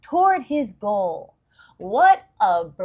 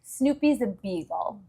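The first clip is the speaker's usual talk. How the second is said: ordinary delivery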